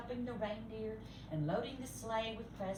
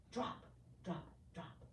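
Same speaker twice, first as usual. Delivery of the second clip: soft falling drops; 4 semitones lower, 11 dB quieter than usual. A narrator's reading. Gumdrops falling.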